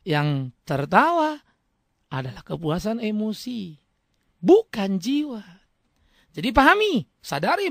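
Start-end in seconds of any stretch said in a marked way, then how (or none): 0.1-5.4 very slow, lower, spaced words; slow, deliberate emphasis. Shown as a demonstration. Preacher driving home his point to the listeners, slow and low. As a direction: lay it out word by word. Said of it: "perhatikan kalimat saya".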